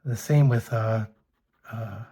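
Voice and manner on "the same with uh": normal talking voice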